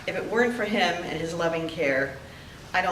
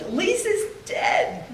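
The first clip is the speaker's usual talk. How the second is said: tearful disbelief; 14 semitones higher, slower than usual. Retold or imitated. Retelling her friend's fall, in tears.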